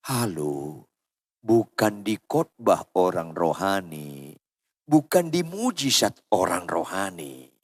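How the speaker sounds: low, measured pulpit admonition; grave preaching. Real, not acted. Preacher correcting the congregation on who is spiritual, solemnly admonishing. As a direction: drop low and let each phrase land.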